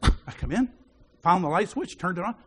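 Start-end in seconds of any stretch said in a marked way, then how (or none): none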